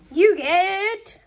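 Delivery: high, drawn-out game-show reveal; big prize reveal. Acted. Giver announcing an Oculus Quest two, excited reveal. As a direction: build suspense, then stretch out the prize.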